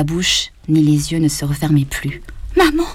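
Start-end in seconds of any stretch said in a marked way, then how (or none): 2.6-2.9 anguished cry. A narrator's reading. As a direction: call out to her dead mother. Daughter sees mother die. (cri déchirant vers maman)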